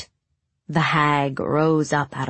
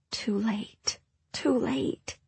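whisper; 7 semitones higher, 7 dB quieter, much slower than usual. A narrator's reading. Despair at distant music.

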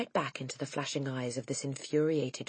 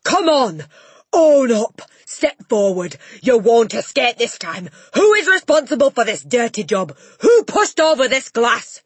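loud, much higher bellowing demands; furious shouting; 9 semitones higher, 13 dB louder than usual. Acted. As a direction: bark every order at the class. Headmistress interrogating the class.

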